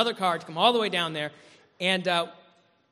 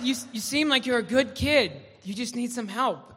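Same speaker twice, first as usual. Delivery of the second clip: kindly cop; 7 semitones higher, 6 dB louder than usual. Retold or imitated. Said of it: "And he said".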